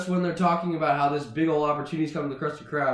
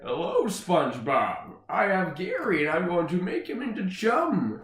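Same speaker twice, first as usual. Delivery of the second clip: put-on scheming villain voice; Plankton. Villain phones SpongeBob about Gary, cartoon menace. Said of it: "it's actually Plankton who picks up".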